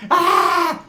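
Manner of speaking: high mocking shriek of Biden; mock scream. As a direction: shriek it like a hysterical lady. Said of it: "She starts going off".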